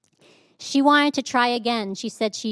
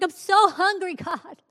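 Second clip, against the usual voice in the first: high-pitched whimpering mock complaint to God; whiny plea; 8 semitones higher, slower than usual. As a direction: replay the hungry despair, pitched high. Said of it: "And I'm like".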